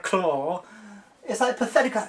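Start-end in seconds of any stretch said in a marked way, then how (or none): none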